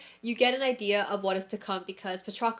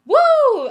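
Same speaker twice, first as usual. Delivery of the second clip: sudden loud high woo to shake off tears; emotional woo, much higher and louder; release whoop; 16 dB louder than usual. Real, not acted. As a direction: let out a wild whoop of emotion. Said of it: "I need to relax".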